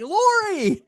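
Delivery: name called out high and bright; excited call. Retold or imitated. Greeting a listener named Lori with a high excited call. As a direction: call his name across the room in delight. Calling out to a friend.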